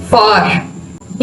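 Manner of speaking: "फार" with a long, slow aa, softly; long aa demo. Shown as a demonstration. Teacher showing students the long vowel in "फार", slow and soft. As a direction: draw out the aa in "फार". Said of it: "ये बड़ा आ बन जाएगा".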